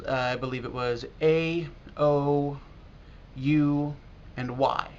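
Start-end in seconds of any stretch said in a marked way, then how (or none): none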